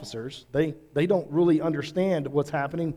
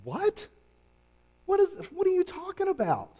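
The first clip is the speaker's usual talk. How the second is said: much higher, disbelieving squeak; incredulous Pharisee. Retold or imitated. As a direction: jump way up in shocked disbelief. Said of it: "you're going".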